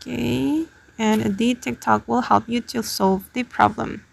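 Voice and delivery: normal talking voice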